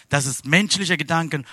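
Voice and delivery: ordinary talk, nothing marked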